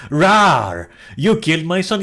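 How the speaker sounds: loud mock roar into an accusation; chainsaw lady. Chainsaw lady blaming someone for her son, mock roar. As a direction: roar it like a cartoonish vengeful mother. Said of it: "the chainsaw lady goes like".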